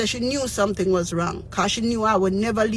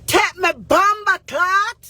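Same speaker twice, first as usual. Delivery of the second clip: high, loud, slow defiant curse; retold furious patois curse; angry curse; 10 semitones higher, 4 dB louder, slower than usual. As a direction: throw it back at them in fury. Cursing at government caller.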